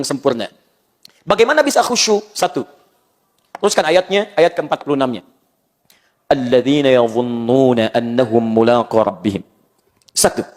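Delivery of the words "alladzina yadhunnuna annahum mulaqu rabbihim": Quranic recitation. Shown as a demonstration. Quoting verse in recitation.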